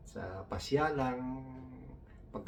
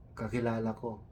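ordinary delivery

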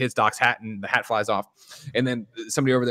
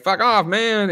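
mock yell; 11 semitones higher, 5 dB louder, slower than usual. Marty confronting Tannen, retold.

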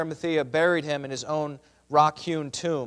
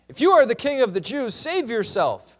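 raised, louder taunt, slowing at the end; mocking; 5 semitones higher, 5 dB louder than usual. A narrator's reading. Soldiers taunt Jesus on the cross, mocking. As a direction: jeer it as a challenge. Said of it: "They're mocking him".

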